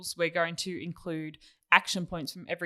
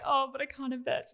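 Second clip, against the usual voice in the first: higher-pitched teasing imitation; mock lament; 7 semitones higher than usual. Co-host teases him about not being able to invest, mock lament. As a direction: imitate a mild complaint, half laughing.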